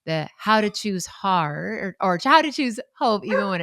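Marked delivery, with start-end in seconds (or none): none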